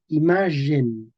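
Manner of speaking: imagen slowly as i-MA-zhen; medieval pronunciation. Shown as a demonstration. Teacher applying the old sound, medieval pronunciation. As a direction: stretch imagen with French-style zh. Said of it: "tenían el sonido j".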